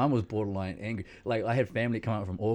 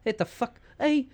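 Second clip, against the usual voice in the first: high, loud furious demands; outraged uncle; 12 semitones higher, 7 dB louder than usual. Retold or imitated. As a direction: rant for the nurse. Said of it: "my uncle was just like".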